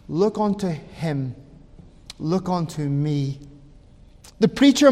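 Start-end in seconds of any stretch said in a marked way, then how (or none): 0.1-3.4 low, soft repeated entreaty; hushed appeal. Retold or imitated. Preacher softly repeats his text.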